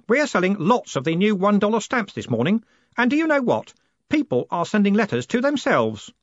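put-on high, excited boy's voice; keen, breaking the amazing news; eager Stanley. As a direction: bubble over with news in a put-on boyish voice.

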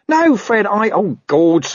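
high, panicky backtracking; squeaky, flustered underling; flustered corporal. Acted. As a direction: stumble over it in dismay. Nobby flustered at his mistake.

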